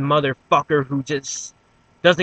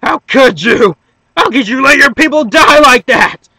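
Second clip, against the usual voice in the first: anguished accusation; 8 semitones higher, 10 dB louder than usual. Acted. Anguished anime hero confronts villain.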